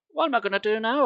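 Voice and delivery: a touch of despair; desperate. Acted. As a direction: worry in a panic. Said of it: "I thought desperately".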